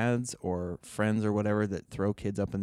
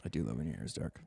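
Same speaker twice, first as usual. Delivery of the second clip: low, soft seductive tease; deep mock voice; 10 semitones lower, 10 dB quieter, faster than usual. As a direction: drop it low and sultry. Husband speaks low.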